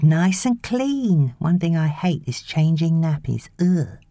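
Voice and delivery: put-on high, drawn-out baby talk; nanny talking to the baby; cooing nanny. A narrator's reading. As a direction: coo it, then a disgusted ugh. Nanny checking nappy.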